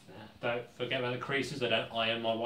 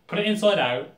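loud instruction; 6 semitones higher, 10 dB louder than usual. Pretend. Hammed-up tutorial.

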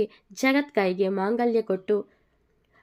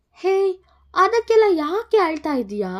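cheering her up; 10 semitones higher, 7 dB louder than usual. Acted. Comforts crying bride.

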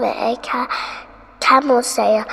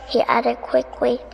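plain reading voice

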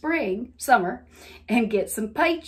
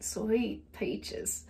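put-on Southern accent; 10 dB quieter than usual. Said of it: "heavy Southern accent".

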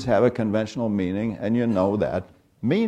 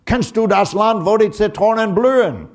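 mock German officer barking "Kennst du das Land" much higher and louder; hammed-up German officer shouting in a thick German accent; heavy German accent shout; 10 semitones higher, 6 dB louder than usual. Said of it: "shout in, in heavy-duty, uh, a German accent".

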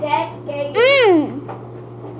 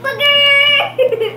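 laughing shout; 8 semitones higher, 4 dB louder than usual. Real, not acted. Mom dreads booger flavour.

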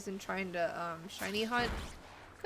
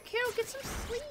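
much higher, sing-song self-reminder; playful high reminder; 13 semitones higher than usual. Pretend. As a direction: nag yourself in a high voice. Telling herself to go sleep.

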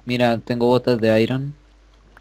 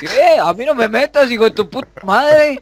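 outraged yelling; 11 semitones higher, 5 dB louder than usual. Real, not acted. Accused, outraged yelling back.

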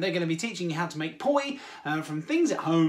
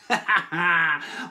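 loud burst of laughter; hearty laugh; 5 dB louder than usual. Acted. Mock reaction to noisy audience.

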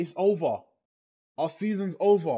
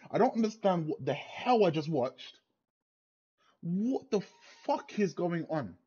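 normal talking voice

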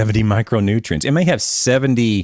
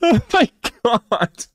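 slow high laughing gasp; can barely get the words out; laughing helplessly; 10 semitones higher, slower than usual. Real, not acted. After the joke, laughing helplessly.

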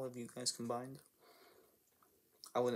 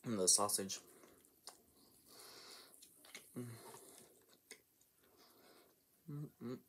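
muffled between bites; talking while chewing; 5 dB quieter than usual. Real, not acted. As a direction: mouth full of sandwich. Seen 0: Holds sandwich, takes bites at 3.9, 6.1, 12.8, and chews between.

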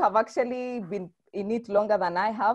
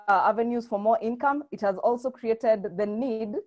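ordinary talk, nothing marked

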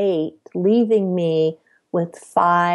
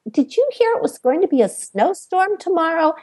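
evasive deflection; 10 semitones higher, faster than usual. Retold or imitated. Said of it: "I would say".

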